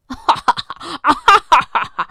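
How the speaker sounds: loud hearty guffaw; bison's big laugh. A narrator's reading. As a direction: roar with laughter at Billy. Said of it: "野牛看了哈哈大笑".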